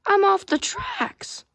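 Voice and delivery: high, slow cracking squeak. Acted. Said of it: "someone just did a voice crack".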